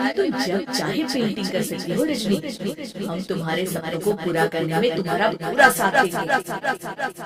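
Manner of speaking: soft, tender promise; reassuring. Acted. Mother-in-law tenderly reassuring her daughter-in-law. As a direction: promise support gently.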